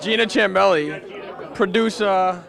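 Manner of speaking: mock announcer. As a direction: announce the guest with playful pomp. Mock grand introduction of arriving producer.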